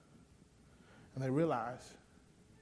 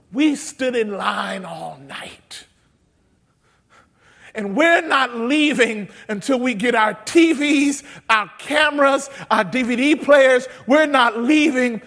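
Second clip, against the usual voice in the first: slow, louder, stubborn refusal; defiant shoppers; 7 semitones higher, 12 dB louder, slower than usual. Retold or imitated. Shoppers refusing to leave the store, loud and defiant. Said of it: "and they said".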